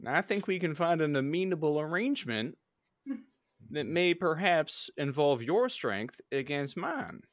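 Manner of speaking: smooth-talking debt collector; Western gambler voice. Acted. Player voices gambler character.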